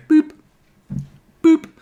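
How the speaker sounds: playful boop boop like a till scanner; mock scanner boop; scanner beep noise. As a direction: mimic the checkout beep. Imitating checkout scanner.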